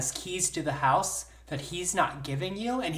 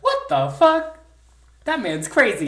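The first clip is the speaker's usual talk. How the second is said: high, loud disbelieving cry; shocked outburst; 11 semitones higher, 11 dB louder than usual. Real, not acted. Shocked reaction to the wink.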